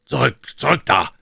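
sharp, commanding order. A narrator's reading. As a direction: bark it as a warning. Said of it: "mit dem scharf befehlenden Klang".

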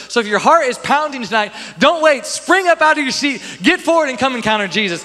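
loud, high altar call; rally the crowd forward; full-force preaching. Acted.

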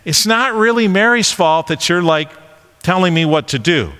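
ordinary talk, nothing marked

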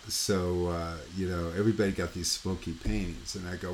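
ordinary talk

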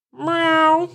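high, drawn-out imitation meow; playful imitated cat cry. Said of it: "That's my best cat voice".

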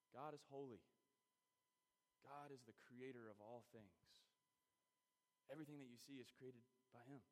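usual speaking voice

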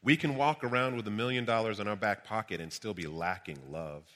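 ordinary talk, nothing marked

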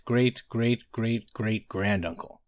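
usual speaking voice

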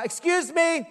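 high call across the counter to get attention. Retold or imitated. To the airline lady with her back turned, calling out. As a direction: pitch it up to reach a turned back. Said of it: "so I just call out".

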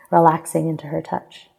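plain reading voice

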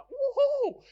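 high-pitched celebratory woohoo; excited whoop. Acted. Acting someone who just won lotto, excited. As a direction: let out a thrilled, high whoop. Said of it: "we're just excited".

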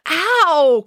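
loud, high yelp of pain; high-pitched "ow" yelp; pained cry. A narrator's reading. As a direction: cry out as if hurt. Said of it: "cried a voice from below".

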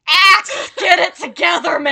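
loud, high, laughing plea; exasperated shout. Retold or imitated. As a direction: yell it while laughing. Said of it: "I spent that whole thing being like".

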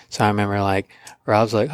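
ordinary talk

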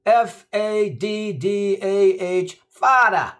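put-on high, slow Queens kid spelling; New York accent. Vinny spells father at the bee, slow Queens accent. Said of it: "Vinny says".

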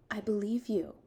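hushed, gentle assurance; soft, quiet little statement; softly. Acted. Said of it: "she said softly".

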